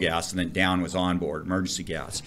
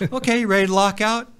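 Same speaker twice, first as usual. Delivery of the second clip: lightly voiced upbeat supervisor, higher; a chipper boss checking in; 11 semitones higher, faster than usual. Supervisor asking the shaken diver if he's ready. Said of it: "He goes".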